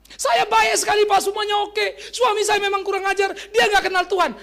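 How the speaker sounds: loud, high-pitched mock complaint; hammed-up indignant wailing; self-righteous wife. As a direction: act a wife shouting her case at the pastor. Acts out self-righteous wife's complaint.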